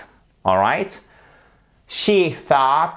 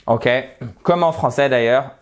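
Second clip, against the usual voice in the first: normal talking voice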